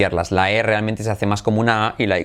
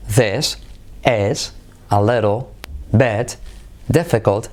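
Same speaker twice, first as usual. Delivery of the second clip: "This is a little bit difficult" broken into slow separate words; slower than usual. Shown as a demonstration. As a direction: say each word slowly with pauses. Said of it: "¿Veis cómo es?".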